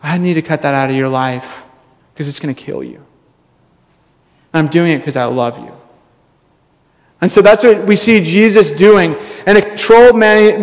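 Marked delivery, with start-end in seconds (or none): 0.0-5.7 low, soft, measured reassurance; calm, loving firmness. Acted. Surgeon telling a patient about the cancer, calm and caring. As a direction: deliver hard news gently, voice dropped right down. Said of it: "He has the precision of a surgeon".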